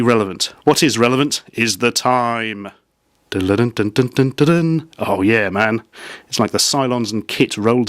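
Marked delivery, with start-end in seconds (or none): 3.3-4.9 sing-out "dun-dun" riff; playful mouthed tune; imitated theme riff. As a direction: vocalise a rhythmic sci-fi theme. Imitates scanning lights' theme.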